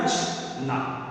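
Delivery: नचना with short नच and clear final ना; short first syllable. Shown as a demonstration. Teacher contrasting it with नाचना, short opening syllable. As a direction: keep नच short, then a separate ना. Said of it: "इस पे कोई मात्रा नहीं है".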